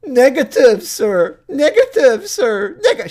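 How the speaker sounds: mocking high, loud parroting; Rob Klein. Ranter parroting the suspect's answer to police, mocking. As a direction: exaggerate his irritating reply in a shrieky pitch. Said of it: "the way he says".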